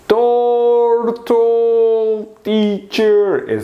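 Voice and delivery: high, long drawn-out vowels; slow motion. Shown as a demonstration. Teacher acting out the turtle, slow motion. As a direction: talk like a slowed-down tape. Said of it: "Slow motion, you see?".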